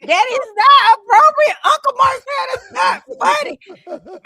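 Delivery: high, loud mock child outburst; slow, high-pitched kid yell; little boy shouting. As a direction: imitate an indignant little kid, drawn out and shouted. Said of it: "Trey was little, he was like".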